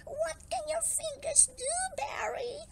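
high-pitched curious little fish; eager question. A narrator's reading. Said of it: "they asked".

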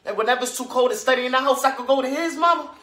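high, loud and bubbling over; eager child calling Mama. Acted. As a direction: pour it out to Mama like an excited kid. Child's eager tale to mother.

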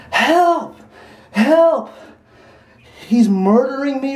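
high mock scream for help; imitated cry for help. Retelling yells heard through the bushes, imitated cry for help. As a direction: act out a distant victim's high-pitched yell. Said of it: "I heard someone yelling".

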